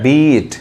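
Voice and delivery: "बीट" with a drawn-out ee; teacher's long-sound demo of "बीट"; long vowel. Shown as a demonstration. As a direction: hold the ee in "बीट" before the t. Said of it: "वही long sound है".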